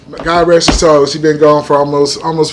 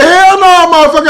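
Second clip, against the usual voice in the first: mock indignant shout; 10 semitones higher than usual. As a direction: blurt it out in mock outrage. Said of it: "I said".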